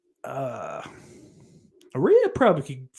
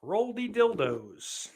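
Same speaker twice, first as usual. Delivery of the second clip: "Roldy Dildos" drawn out slowly, higher, savouring each word; savoured nickname; 8 semitones higher, 5 dB quieter, slower than usual. Acted. A nickname that's gonna stick.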